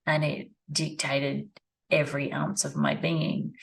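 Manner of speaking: ordinary talk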